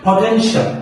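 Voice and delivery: পটেনশিয়াল high, as "po-TEN-shal", rising at the end; pronunciation example. Shown as a demonstration. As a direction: model পটেনশিয়াল with a lifted ending. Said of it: "একটা উদাহরণ দিই". (পটেনশিয়াল উঁচু স্বরে, উচ্চারণ দেখিয়ে)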